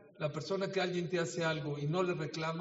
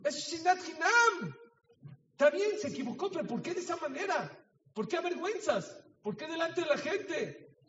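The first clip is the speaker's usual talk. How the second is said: raised rebuke; 10 semitones higher, 3 dB louder than usual. Real, not acted. Preacher rebuking harsh spouse.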